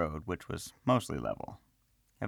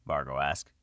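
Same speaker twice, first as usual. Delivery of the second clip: ordinary delivery, nothing marked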